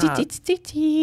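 high coaxing kitty call; calling a cat. Real, not acted. (magas, csalogató cicahívás)

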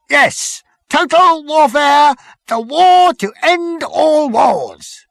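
high, loud, slow war proclamation; fanatical military officer. Acted. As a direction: relish every word of the war cry. Number Two proclaims war.

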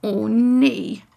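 langgerekte, moedeloze kreun; kreunend. A narrator's reading. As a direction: kreun het vermoeid uit. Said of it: "kreunt Victor".

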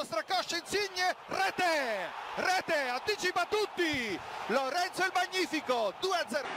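loud, breathless goal scream; breathless italian goal commentary. Real, not acted. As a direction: roar the goal out.

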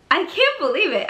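high, excited disbelief; amazed disbelief. Real, not acted. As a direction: gasp it out in wonder. Repeats in disbelief.

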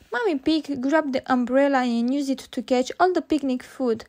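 ordinary delivery, nothing marked

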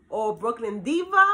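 usual speaking voice

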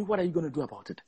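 hushed divine question dropped low and soft; God's voice, quiet. Retold or imitated. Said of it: "Literally, God said to me".

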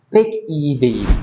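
ordinary talk

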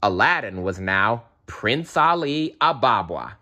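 usual speaking voice

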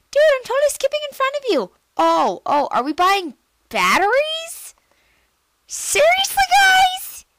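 very high and loud, rushing; hyper excited. Real, not acted. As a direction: bounce off the walls with glee. Kid reacting to game characters.

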